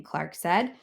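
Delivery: plain reading voice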